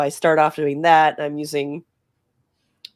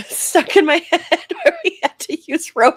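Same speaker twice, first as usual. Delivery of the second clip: giggling through the words; laughing; 13 semitones higher, 5 dB louder than usual. Real, not acted. Player laughing to the table about a past rope gag, giggling. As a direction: laugh while remembering the rope bit. Recalling a funny one-shot moment.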